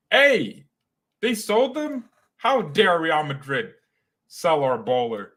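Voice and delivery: high-pitched indignant disbelief, easing by the end; mock outrage. Real, not acted. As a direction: protest like a scandalized fan. Mock outrage at player's sale.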